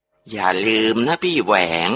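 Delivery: eerie ghost voice. Acted. Possessed aunt reminds Uncle Waeng of a promise, eerie slow ghost voice. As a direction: let a dead woman speak through the possessed aunt. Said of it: "เสียงป้าจำปีก็พูดออกมาอีก".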